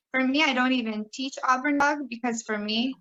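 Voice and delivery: ordinary delivery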